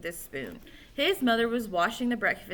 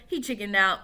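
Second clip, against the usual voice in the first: usual speaking voice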